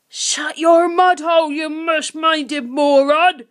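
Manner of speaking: slow, loud, high barked insult; angry bark. A narrator's reading. Grunt silencing foolish Guff, barking. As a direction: bark each word slowly and loudly. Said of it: "Grunt barked".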